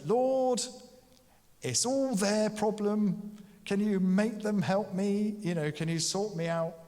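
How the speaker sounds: high, loud, put-upon whine at the Lord; stressed moaning prayer. Retold or imitated. Martha moaning to Jesus about her sister, high exasperated whine. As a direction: plead as if everyone else is to blame. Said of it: "That's the prayer of the stressed".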